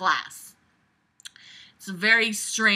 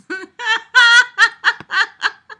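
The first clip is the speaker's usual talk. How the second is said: real helpless laughter, pitched way up; high wheezing laugh; 10 dB louder than usual. Real, not acted. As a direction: lose it laughing at the writing. Laughs at the bad dialogue.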